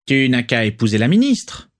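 pique moqueuse, timbre changé; raillerie. A narrator's reading. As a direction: se moquer de Rosier en douce. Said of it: "railla Parkinson". (Parkinson raille Rosier, ton moqueur)

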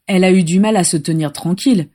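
ordinary delivery

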